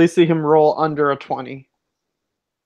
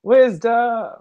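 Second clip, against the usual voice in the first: excited; 7 semitones higher, much slower than usual. Real, not acted. Announcing roll excitedly.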